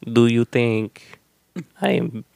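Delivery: put-on slow, drawn-out Cuban-Spanish accent; Ana de Armas impression. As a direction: purr it slowly in a Cuban accent. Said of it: "That's a great accent".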